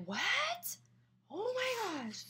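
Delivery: very high, thin mock gasp of amazement; excited disbelief. Guests hear of hundred dollar prize, mock astonishment. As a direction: imitate stunned guests without raising the volume. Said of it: "People were really excited".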